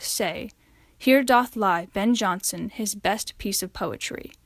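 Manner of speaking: slow, solemn poem reading, lines falling softly; poem recitation. Acted. Student reading Ben Jonson's elegy to class, solemn recitation. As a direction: read the elegy with quiet grief.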